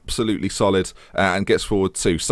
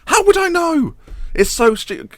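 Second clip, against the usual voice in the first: high squeal; slower than usual. Real, not acted. Mock outrage at own prediction.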